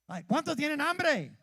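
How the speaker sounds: high, loud preacher's shout; real fervent shout to the church; shouted question. Real, not acted. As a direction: rouse the crowd with the question. Preacher calling out to congregation.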